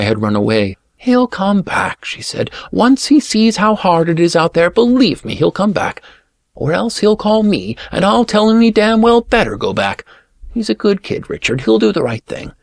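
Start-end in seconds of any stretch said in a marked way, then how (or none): 1.0-2.0 high, slow woman's voice; lightly voiced higher woman; sister-in-law voice. A narrator's reading. 2.7-12.5 higher, insistent woman's voice; sister-in-law voice. A narrator's reading.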